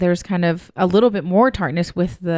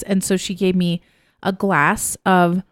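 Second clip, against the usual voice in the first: ordinary talk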